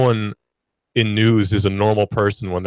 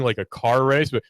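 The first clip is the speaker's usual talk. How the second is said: ordinary talk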